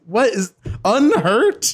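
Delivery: high, slow, incredulous question; mock bafflement. Player reacting to an odd word, mock bafflement. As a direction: stretch it out in exaggerated disbelief.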